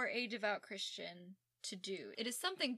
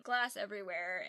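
usual speaking voice

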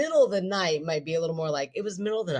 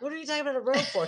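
incredulous quote; 10 semitones higher, much faster than usual. Retold or imitated. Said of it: "I was like".

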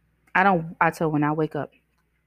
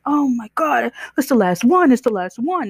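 high, louder, over-the-top despair; hammed-up panic over the last burrito; mock frantic wail; 8 semitones higher, 5 dB louder than usual. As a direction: play the end of the world over a burrito. Man raging at burnt burrito.

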